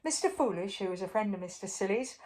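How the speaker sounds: plain reading voice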